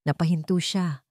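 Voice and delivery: plain reading voice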